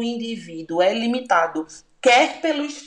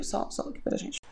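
whispered aside; 8 dB quieter than usual. Real, not acted. Confiding aside to listeners.

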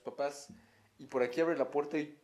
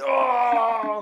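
mock shout; 8 semitones higher, 10 dB louder than usual. Imitating video's shout.

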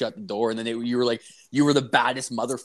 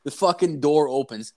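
ordinary talk

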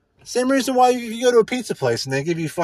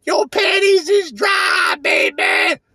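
high, slow, hammed-up radio DJ howl; playful jive DJ voice, pitched way up; Wolfman Jack impression; 12 semitones higher, 4 dB louder, slower than usual. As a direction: stretch it out like a wild late-night DJ. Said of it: "the Wolfman Jack's like".

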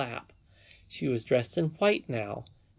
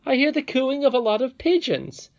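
put-on high, loud, insistent boy; boy Curdie, exasperated; 7 semitones higher, 5 dB louder than usual. A narrator's reading. Curdie refuses to believe the princess, raised and exasperated. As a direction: argue back hotly, voice raised.

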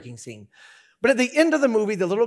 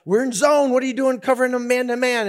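raised, higher coach's complaint; mock exasperated coach; grumpy football coach; 6 semitones higher, 4 dB louder than usual. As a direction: imitate a coach scolding a player. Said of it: "grumpy all the time".

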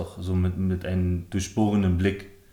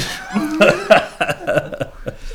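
breathy laugh; 8 semitones higher, 6 dB louder than usual. Real, not acted. Laughs at request to speak.

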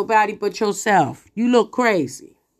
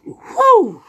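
a sudden high, loud whoop; high loud woo; 10 semitones higher, 5 dB louder than usual. Real, not acted. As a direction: let out a startled high woo. Reacting to scary scripture.

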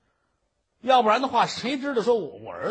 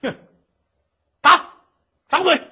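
much higher, much louder order; enraged judge screaming for the face to be slapped; furious shout; 10 semitones higher, 12 dB louder than usual. Acted. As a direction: yell the punishment at the guards.

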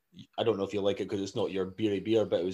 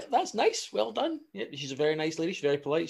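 higher-pitched, awkwardly polite parent; acted embarrassed politeness; 7 semitones higher than usual. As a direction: cover up with hasty praise. Covering for child's embarrassing remark.

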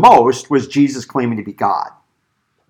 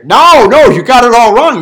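loud, high mock protest; emphatic denial; 8 semitones higher, 7 dB louder than usual. Preacher voicing what Jesus does not say, loud protest. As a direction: imitate someone flatly contradicting. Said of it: "he doesn't say".

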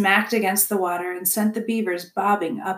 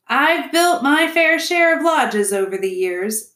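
higher, louder proud declaration; projected, self-important beaver; seasoned beaver builder; 6 semitones higher, 5 dB louder than usual. Acted. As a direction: hold forth as an old hand at lodges. Mister Beaver boasts.

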